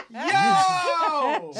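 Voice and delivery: high, loud whoop through laughter; laughing shout. Real, not acted. Reacting to a friend's outrageous joke, laughing shout. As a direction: holler it while cracking up.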